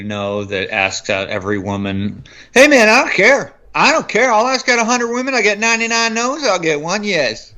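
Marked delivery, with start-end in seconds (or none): 2.6-7.5 high, loud pickup-artist bluster; joke's brazen guy. Retold or imitated. Retelling a comic's joke about asking out every woman, high brash voice. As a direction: voice a shameless guy pitched way up. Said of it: "he's like the David Cross joke about the guy".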